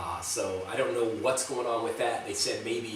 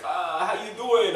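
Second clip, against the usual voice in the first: put-on slow, higher-pitched Italian-accented greeting; 8 semitones higher, slower than usual. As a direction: imitate an Italian friend's English, drawn out. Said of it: "cooler accent in English than an Italian".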